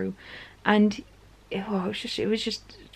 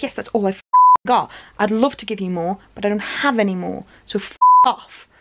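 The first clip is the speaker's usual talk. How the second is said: losing it; 5 dB louder than usual. Retold or imitated. Friend finally loses it at pushy beggar, imitated losing it. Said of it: "Andrew lost his sh-, like, instantly".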